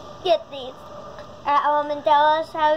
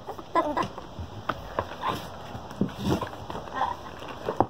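wheezing unvoiced laughter; breathy silent laugh; 9 dB quieter than usual. Real, not acted. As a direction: laugh without voice. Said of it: "Sorry I was laughing so much".

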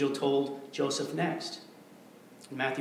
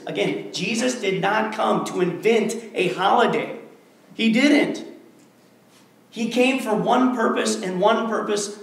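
louder, driving pulpit declaration; full-force preaching; 4 semitones higher, 7 dB louder than usual. Acted. Pastor hammering the point home to his congregation, forceful preaching.

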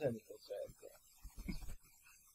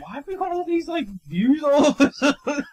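mock puzzlement; 11 semitones higher, 9 dB louder than usual. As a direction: play baffled and amused. Acted puzzled, laughing.

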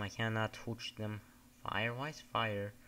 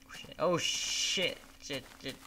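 panicked swearing; 3 semitones higher than usual. Real, not acted. Sudden danger in game.

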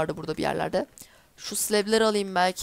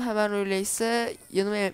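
normal talking voice

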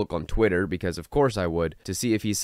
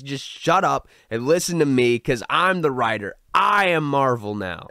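arrogant writer parody; 6 semitones higher, 4 dB louder than usual. Retold or imitated. Scene: vlogger mocks the writer insulting comic fans, hammed-up arrogant voice. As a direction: puff up as a smug self-important writer. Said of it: "he goes".

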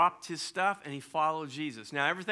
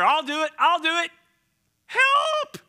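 much higher, loud, cracking into a yelp; eager then terrified; panicked cry; 12 semitones higher, 10 dB louder than usual. Acted. Peter sinking in the water, panicked loud cry.

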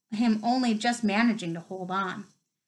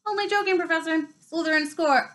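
a much higher, quick mock apology; cheeky commentator; 7 semitones higher than usual. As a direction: wriggle out of trouble with the teacher. Commentator placates teacher.

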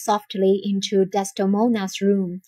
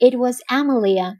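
ordinary delivery, nothing marked

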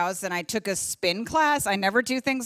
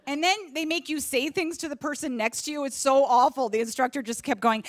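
ordinary talk

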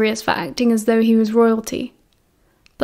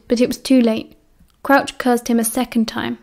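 ordinary delivery, nothing marked

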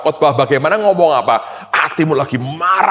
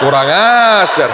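indignant; 4 semitones higher, 6 dB louder, slower than usual. Retold or imitated. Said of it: "Saya bilang".

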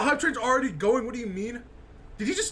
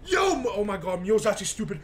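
normal talking voice

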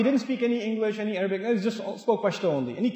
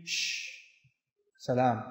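very soft, low "salam" after a shush; quiet, lowered, disarming greeting; calm hushing; 8 semitones lower, 10 dB quieter, slower than usual. Shown as a demonstration. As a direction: hush them gently and peacefully. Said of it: "They speak calmly".

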